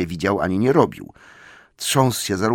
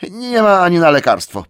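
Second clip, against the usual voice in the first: high, loud hurried fix; hasty correction; 10 semitones higher, 9 dB louder than usual. Acted. Bilbo corrects himself in a high loud panic. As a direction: quickly take it back. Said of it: "poprawił się natychmiast".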